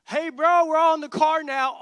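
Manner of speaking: loud call to a late friend. Retold or imitated. Friend yelling at the late roommate to get in the car, loud. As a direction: holler at him to hurry.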